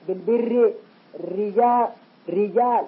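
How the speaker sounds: بالبر and رجال with a thin, light R. Shown as a demonstration. Teacher demonstrates light R words. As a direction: keep each R thin. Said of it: "ترقق".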